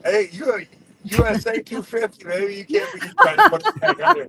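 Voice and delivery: high, loud playful USA chant, breaking into a laugh; mock patriotic cheer. Teasing the British guest about the tea, in a high, loud mock-patriotic cheer. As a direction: ham up the American pride, cracking up. Jokey American pride vs Commonwealth.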